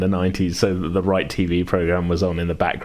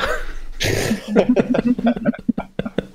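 long breathy laugh. Real, not acted. Laughing at own joke about the setting.

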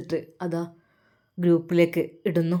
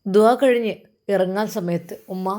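ordinary delivery, nothing marked

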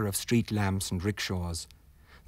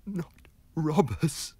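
higher, slow frightened voice; terrified gasp; 8 semitones higher, slower than usual. Acted. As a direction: plead it out in panic. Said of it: "gasped the terrified man".

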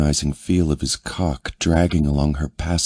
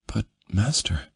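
higher, softer woman's voice; 5 semitones higher, 4 dB quieter than usual. A narrator's reading. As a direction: voice her timid and higher.

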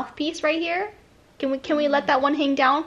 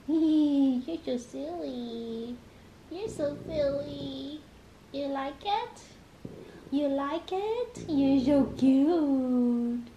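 much softer, slow cooing to a pet; 2 semitones higher, 9 dB quieter, slower than usual. Real, not acted. Owner coos softly to her dog Bronx, tender baby talk. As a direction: coo softly like to a puppy.

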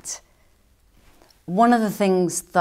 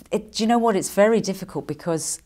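ordinary talk

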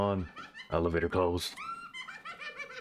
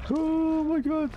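high terrified gasp of words; frightened, drawn out; scared horror game streamer; 4 dB louder, slower than usual. Real, not acted.

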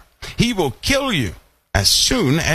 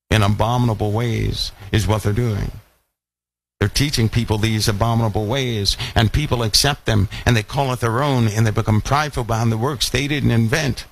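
ordinary delivery

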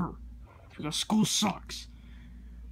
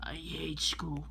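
whisper; 2 semitones lower, 8 dB quieter, slower than usual. Real, not acted. Whispered aside.

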